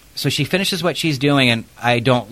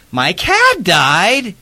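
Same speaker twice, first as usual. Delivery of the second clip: high, louder, slow stunned question; shocked disbelief; 8 semitones higher, 6 dB louder, much slower than usual. Retold or imitated. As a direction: let the news land in disbelief. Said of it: "She goes".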